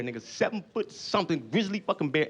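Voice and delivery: ordinary talk, nothing marked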